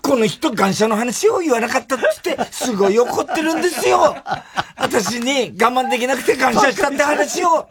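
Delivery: high-pitched mock appeal; imitated complaining wife. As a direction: plead her case in a sustained high voice. Retold wife's indignant appeal to hosts. (高い声で訴える妻の物真似)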